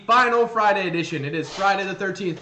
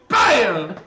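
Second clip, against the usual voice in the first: shouted gunshot noise; mock gunshot; 7 dB louder than usual. Slasher shooting campers, loud imitated bang. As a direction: bark out a fake gunshot. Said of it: "gunshot again".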